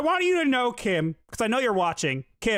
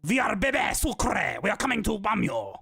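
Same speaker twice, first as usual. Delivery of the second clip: put-on Canadian French accent. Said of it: "probably said it in a Canadian French accent".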